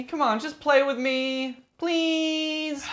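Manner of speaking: high, slow, drawn-out pleading; whiny begging. Acted. Zombie begging survivor to play a game, whiny. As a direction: beg like a kid, stretching the last word.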